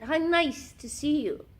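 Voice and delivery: high, posh lady voice; Sloane Ranger voice. Retold or imitated. Tim Curry finding his character voice, high society lady. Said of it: "So he does that".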